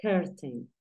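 "curtain": first vowel like an E, not an O; curtain with an E sound. Shown as a demonstration. Teacher models curtain for students, first vowel as E. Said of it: "como con una E".

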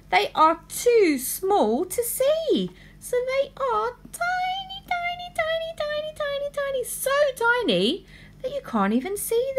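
high sing-song tiny. Acted. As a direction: squeak it small and sing-song. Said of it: "maid explains tiny germs".

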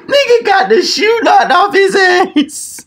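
very high-pitched, incredulous exclamation; amused amazement. Real, not acted. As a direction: squeal it like you can't believe the knockout. Amazed at shoe knocked off.

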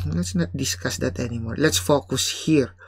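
ordinary delivery

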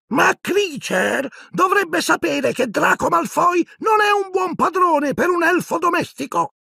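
very high, slow, defiant squeaky shout; defiant elf. A narrator's reading. Dobby defiantly challenging Creature, very high slow shout. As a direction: stand up to the other elf. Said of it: "in tono di sfida".

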